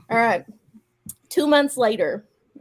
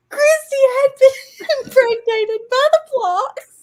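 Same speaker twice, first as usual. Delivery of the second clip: higher, louder, slowed by laughter; reading while cracking up; laughing; 8 dB louder, slower than usual. Real, not acted. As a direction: fight through the giggles. Reader breaks up mid-sentence.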